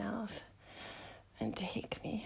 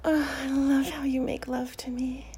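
ordinary talk, nothing marked

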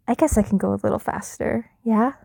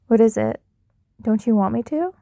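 usual speaking voice

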